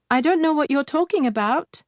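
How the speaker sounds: fast, loud and high in panic; frightened cry. Acted. Husband denies stealing to the witch, panicked. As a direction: blurt it out in fear. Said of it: "Samar cried".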